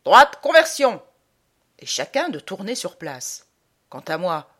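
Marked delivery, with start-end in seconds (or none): 0.0-1.0 high, very loud drill order; barked parade-ground command; shouted command. Acted. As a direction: shout the manoeuvre at the troops. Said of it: "on nous crie en face".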